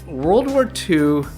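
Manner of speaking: ordinary talk, nothing marked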